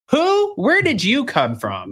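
stunned disbelief at advice from nowhere; shocked. Real, not acted.

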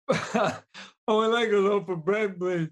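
put-on high, mushy kid voice; shy kid asking again; kid with speech impediment. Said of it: "pushes the kid ahead".

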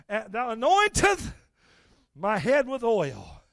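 high, loud, chanted sermon climbing to shouts; full-force preaching. Acted. Preacher reciting the psalm and Caleb's story to the church, roaring. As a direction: preach it hard, building until you're shouting.

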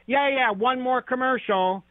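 put-on high-pitched kid putting off the parent; imitated child's pleading stall. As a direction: play the child brushing it off, voice up high. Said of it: "And the child goes".

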